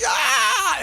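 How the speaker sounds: a whole crowd shouting yeah; crowd cheer. Retold or imitated. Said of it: "everybody was like".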